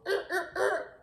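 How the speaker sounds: high laughing er er er; high giggly stutter; laughing stammer. Retold or imitated. Plot swerving, laughing.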